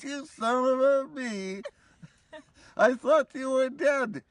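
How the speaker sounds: pitched high with disbelief at the reunion; surprised exclamation. Retold or imitated. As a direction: say it stunned and delighted.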